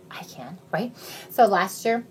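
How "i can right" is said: soft whispered aside. Real, not acted. Answering her own question, whispered. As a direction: whisper it conspiratorially.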